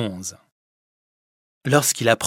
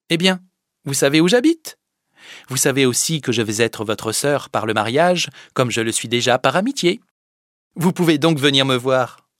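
higher, louder brisk invitation; lively Fadette; 4 semitones higher, 7 dB louder than usual. Acted. (invitation vive de la fadette)

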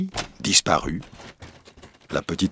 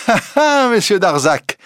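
high, crowing "ha, ha"; triumphant laugh; 5 dB louder than usual. A narrator's reading. Reporter turns to the accused man, crowing laugh. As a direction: crow over being proved right. Reporter crows at being believed.

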